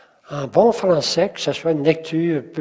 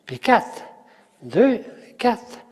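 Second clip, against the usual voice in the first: higher, separate beats on "Deux" and "quatre"; counted rhythm; 6 semitones higher, slower than usual. Shown as a demonstration. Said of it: "C'est ça le rythme". (« Deux, quatre » scandé, voix montante)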